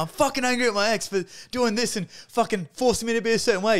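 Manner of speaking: angry shout. Retold or imitated. Retreat participant answering the facilitator, shouting in fury. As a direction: shout it high and loud, raw and furious. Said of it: "I'm like".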